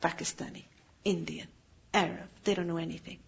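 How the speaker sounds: low, soft brush-off; dismissive. Acted. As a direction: write them off under your breath. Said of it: "that is where we cross them out".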